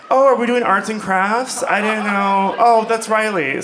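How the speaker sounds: high, loud, innocent confusion; mock surprised friends. Friends mistaking pale comic for poster board, mock surprised. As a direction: imitate friends puzzled, then realizing their mistake.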